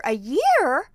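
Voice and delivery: loud, high disbelief; mock amazed in-law; shocked question.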